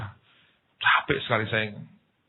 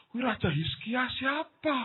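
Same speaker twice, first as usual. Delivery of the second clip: high, softer, slow question; mock puzzlement; 13 semitones higher, 6 dB quieter, slower than usual. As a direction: wonder aloud in a drawn-out high voice. Pura-pura bingung soal Raja Hizkia. (pura-pura bingung, pelan bernada tinggi)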